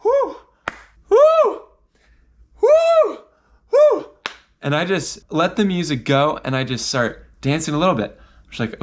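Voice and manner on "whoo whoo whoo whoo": high, loud whoops to psych himself up; nervous whoops. Retold or imitated. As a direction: let out loud shaky whoos, way up high. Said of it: "I'm just freaking out and I go".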